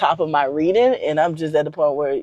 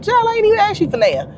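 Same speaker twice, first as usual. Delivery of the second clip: mock disbelief, pitched way up; high squeal of surprise at an unasked-for raise; incredulous. I'm like.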